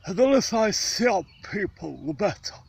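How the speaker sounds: lofty Shakespearean luvvie; Sir John Gielgud. Retold or imitated. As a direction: declaim it like a grand stage knight. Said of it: "As Sir John Gielgud would say".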